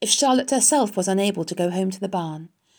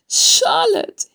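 high, loud wail of the name; anguished moan; 8 dB louder than usual. A narrator's reading. Wilbur learns his friend is dying, anguished moan. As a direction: wail it out in grief. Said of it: "he moaned".

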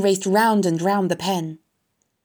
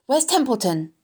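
demanding; 7 semitones higher than usual. A narrator's reading. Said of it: "he demanded".

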